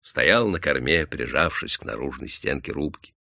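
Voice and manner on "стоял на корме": plain reading voice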